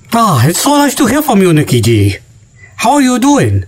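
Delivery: mock Chinese accent. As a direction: greet the caller in a hammed-up Chinese accent.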